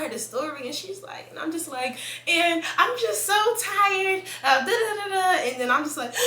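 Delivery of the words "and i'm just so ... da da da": high, slow, tearful whine; imitating her own breakdown, voice cracking high; retold crying. Venting to her new friend, mock crying. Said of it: "I just start bawling".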